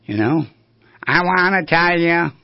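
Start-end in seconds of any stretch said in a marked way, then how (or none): 1.0-2.3 loud, high nasal impression; nasally voice. Imitating a favorite guy on the radio, nasally voice. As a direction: push it up into the nose, louder and higher. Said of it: "has a nasally voice".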